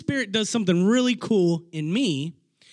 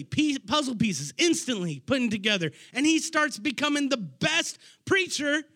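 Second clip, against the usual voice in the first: ordinary talk, nothing marked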